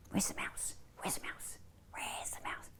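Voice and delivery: hushed, voiceless searching whisper; very soft playful whisper. Pretend. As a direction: whisper so as not to scare it. Calling the tiny mouse in story.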